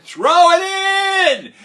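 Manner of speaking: slow, loud, high shout across the field; coach's yell. Retold or imitated. Other coach yelling to the kids in the outfield. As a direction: call it out long across the outfield.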